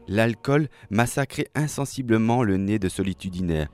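ordinary delivery

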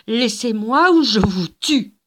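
lente menace criée, plus forte; menace furieuse. Acted. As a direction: menacer de tuer, en rage. Gilda menace les agents. (Gilda menace, voix forte et lente)

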